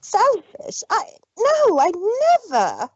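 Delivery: high, startled protest; shocked disbelief. Acted. Rarity stunned at being called selfish, high and shocked. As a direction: squeak it out in disbelief.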